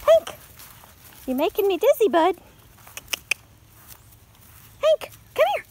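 high sing-song call to the dog. Real, not acted. Owner calls her spinning dog over, high baby talk. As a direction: call him over in a cooing voice.

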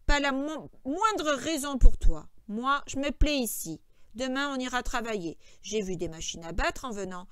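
loud, emphatic start, then settling; stubborn George. A narrator's reading. George refusing to go to the ranch for supper, stubborn. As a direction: dig in and refuse.

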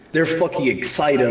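normal talking voice

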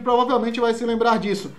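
usual speaking voice

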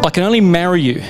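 ordinary talk, nothing marked

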